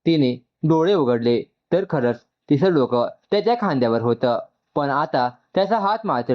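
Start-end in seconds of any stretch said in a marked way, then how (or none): none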